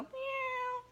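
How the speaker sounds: high, thin mock meow of a cat; imitated cat meow. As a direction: squeak it out like the old cat. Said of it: "And he'd go".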